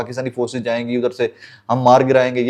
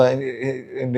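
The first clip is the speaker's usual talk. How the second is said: ordinary talk, nothing marked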